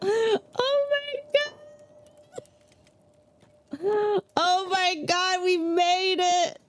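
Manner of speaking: slow, high, laughing relief; relieved laughter. Real, not acted. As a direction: laugh it out, finally safe. Laughing relief after escaping.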